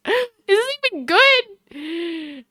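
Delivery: slow, high, shocked question; gasp, then doubt it aloud; slow high gasp of horror; gasping dismay. Real, not acted. Gasp at vegan biscuit result.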